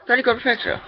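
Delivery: squeaky mock praise.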